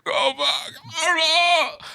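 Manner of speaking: very high, slow strangled squeal; mock struggle to force words out without air; imitated winded gasping. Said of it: "trying to breathe and you cannot do it".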